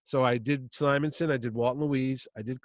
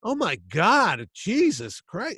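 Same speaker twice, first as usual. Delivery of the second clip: slow, drawn-out exasperation; exasperated groan; 6 semitones higher, 5 dB louder, much slower than usual. Retold or imitated. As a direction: sigh it out in disbelief. Chaykin exasperated.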